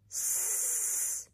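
hissed "S" with no voice; stretched s. Shown as a demonstration. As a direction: stretch out the "S" hiss. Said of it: "stretch and shrink see".

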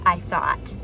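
usual speaking voice

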